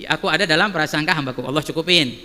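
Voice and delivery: usual speaking voice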